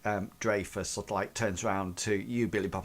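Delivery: ordinary talk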